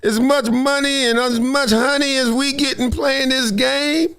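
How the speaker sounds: high, slow laid-back [name] voice; [name] drawl. Retold or imitated. Former teammate telling him to keep playing, [name]-style drawl. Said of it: "he said it like on that [name] album".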